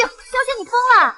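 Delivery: high, loud disbelief; shocked outburst. Acted. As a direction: blurt it out in shock. Maid shocked by decision.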